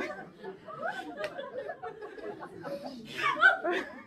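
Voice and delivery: helpless high-pitched giggling; real fit of laughter; high burst of laughter. Real, not acted. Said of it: "Le po".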